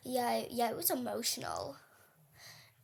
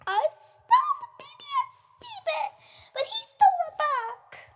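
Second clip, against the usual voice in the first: squeaky mock complaint; 11 dB louder than usual. Real, not acted.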